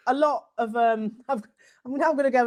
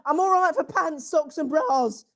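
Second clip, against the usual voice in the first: ordinary delivery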